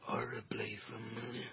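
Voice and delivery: low, whispered dread; grim whisper. Acted. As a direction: let it fall low and ominous. Grim whispered answer about uncle.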